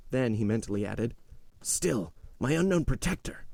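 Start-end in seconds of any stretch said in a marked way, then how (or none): 1.6-3.4 joyful inner exclamation. A narrator's reading. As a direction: voice rising high in relieved joy. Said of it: "leaping with joy".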